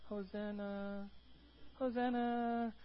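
soft, slow, half-hearted hosanna; limp quiet hosanna. Shown as a demonstration. Preacher mocking a timid crowd, limp and quiet. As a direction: mumble it like you don't care. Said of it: "They weren't like".

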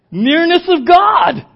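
slow, loud, high cry; shout. Acted. Pastor proclaiming God's nearness to the church, a slow loud shout. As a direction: proclaim it to the back row.

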